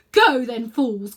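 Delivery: loud scornful call. A narrator's reading. Witch calling after the fleeing children, loud scornful. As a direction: call after them with contempt. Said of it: "called the witch".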